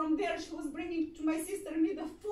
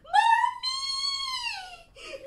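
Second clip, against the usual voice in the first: put-on high, loud crying scream of a child; desperate child screaming for mother; child's scream; 7 dB louder than usual. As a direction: scream it with all your might. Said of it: "he screams with all his might".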